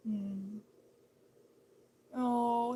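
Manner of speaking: ordinary talk, nothing marked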